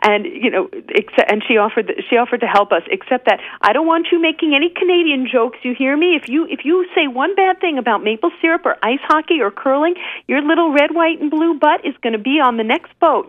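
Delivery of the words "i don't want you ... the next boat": put-on much higher, bossy Canadian accent; mock-stern Canadian nurse laying down the law. Canadian nurse warning the travelers off jokes, Canadian accent. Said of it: "I don't know if I can do a Canadian accent justice".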